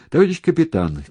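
high, loud shout to an officer; calling out. A narrator's reading. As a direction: call to the captain over wind and water. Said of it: "крикнул он Громову".

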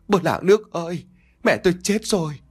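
high, fake wail to the neighbours; hammed-up cry for the whole village to hear. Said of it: "kêu lên".

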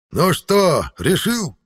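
strong, ringing, high demand; commanding. Acted. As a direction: demand it with authority. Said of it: "сильным и звучным голосом, в котором звенела властность".

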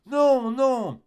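high, drawn-out refusal; protesting wife. Acted. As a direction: push him away with a high wail of no. Wife refuses her husband's advances.

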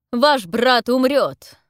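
booming, drawn-out roar; thundering. A narrator's reading. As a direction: thunder the threat like a storm. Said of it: "Голос лорда Демби гремит, как гром".

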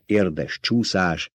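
ordinary delivery, nothing marked

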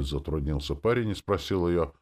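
ordinary delivery

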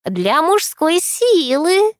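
slow, sly, high crone voice; drawn-out knowing crone tease; sly old crone. A narrator's reading. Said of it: "хитро поглядела старуха".

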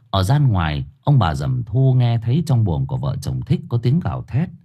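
ordinary delivery, nothing marked

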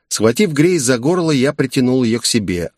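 ordinary delivery, nothing marked

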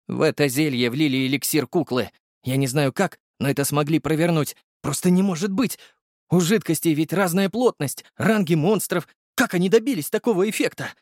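high, wondering voice speeding up at the end; the potion maker baffled by the elixir; puzzled alchemist. Acted. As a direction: puzzle it out aloud, climbing high. Volodya puzzling.

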